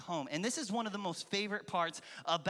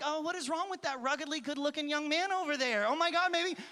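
onlookers' gossip; 7 semitones higher than usual. Retold or imitated. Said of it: "be all like".

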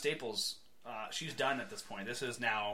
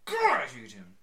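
loud, slow, sneering hatred; hammed-up disgust at a villain; mock outrage; 6 semitones higher, 14 dB louder, slower than usual. Real, not acted. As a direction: spit out his name like a curse. Venting hatred of Reggie.

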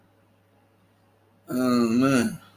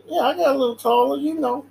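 higher, fast playful brag; mock boast; 10 semitones higher, faster than usual. Son answering the flattery, quick high mock boast. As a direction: brush it off like a proud kid. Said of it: "talking like".

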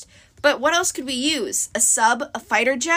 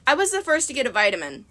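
usual speaking voice

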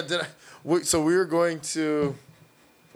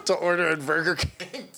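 ordinary talk

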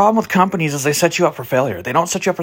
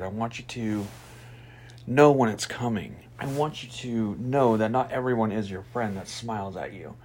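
soft reflective intro; 9 dB quieter than usual. Acted. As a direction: ease listeners into the story softly. Soft slow opening monologue.

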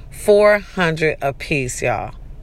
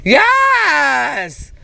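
excited cheer; 5 semitones higher, much slower than usual. Retold or imitated. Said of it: "I was like".

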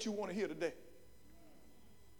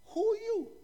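higher repeated question; 12 semitones higher than usual. Acted. Delegation asking John a second time, high-pitched questioning. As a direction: ask "who are you" high, in disbelief.

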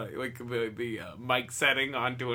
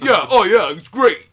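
loud, higher, mumbled Stallone impression; fake Stallone; 7 semitones higher, 15 dB louder than usual. Beachgoer answering the reporter as a fake Stallone. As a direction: belt it like a dopey Stallone. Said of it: "the fake Ron, Sylvester Stallone thing".

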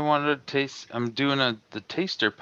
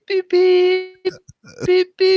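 much higher, louder beep noises like a censor tone; imitated bleep; 8 dB louder, much slower than usual. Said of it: "it's gonna be just beep".

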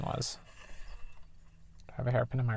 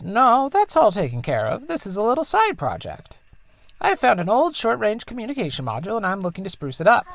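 higher, lightly put-on mother voice; mom's voice; 9 semitones higher, 5 dB louder than usual. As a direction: play the tinkering engineer mom, a bit higher. Voices the mom in dialogue.

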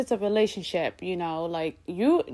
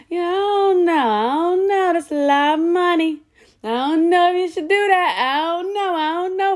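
higher, hesitant mom voice repeating I don't know; mother unsure about her child's plan, higher and hesitant; 7 semitones higher, 3 dB louder than usual. Retold or imitated. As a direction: play a doubtful mother fretting over the cost. Mom voiced hesitating over money.

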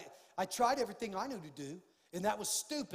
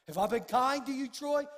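loud pointed question; 6 semitones higher, 8 dB louder than usual. Retold or imitated. God's voice in retold talk.

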